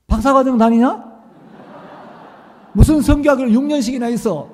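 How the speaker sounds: loud, fast teasing jab; mocking friends. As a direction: rib him like an old buddy. Friends teasing him loudly.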